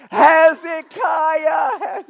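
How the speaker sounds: full-throated, very high, long-drawn yell; re-enacted yelling. Pastor re-enacting his own cheer at the pie event, yelling. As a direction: holler the name, stretching it right out. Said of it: "I was yelling".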